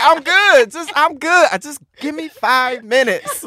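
high, teary, laughing denial; high laughing choked-up voice. Retold or imitated. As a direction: play it off while tearing up. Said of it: "I was like".

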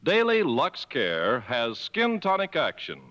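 ordinary delivery

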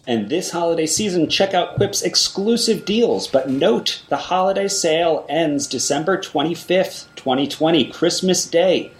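upbeat ad read with promo code; holiday sale ad. Acted. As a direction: sell the holiday deal.

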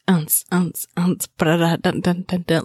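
beatbox a phone's ringtone rhythm; imitating phone beat. Mocking a friend's phone noise, beatboxed. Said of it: "That's what your phone's doing".

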